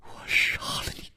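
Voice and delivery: fast, breathy hiss of rage; hissing whisper. A narrator's reading. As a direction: hiss the threat through a whisper. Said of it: "忽然狂吼起来".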